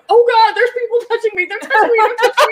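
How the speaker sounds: high, fast squeal of panic; panicked daughter. Retold or imitated. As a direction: squirm away in horror, laughing. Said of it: "And she's like".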